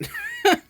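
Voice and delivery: a quick high burst of laughter; short squeaky laugh, amused; sudden high giggle. Real, not acted. Laughs at scope.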